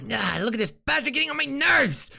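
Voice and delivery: high, loud exasperated screech; hammed-up outburst at the end of one's patience; Marut. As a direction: shriek it in a strained character voice. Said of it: "Marut sounds like that".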